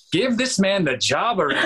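high, hammed-up producer exclamation; playful mock-insistent cry; exclaimed verdict. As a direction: burst out as an impressed casting panel, half laughing. Acting out producers won over.

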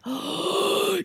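high, drawn-out gasped oh; gasp, then a high held oh; sharp gasp. Retold or imitated. Viewer watching her hold back, gasping oh. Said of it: "and I'm like".

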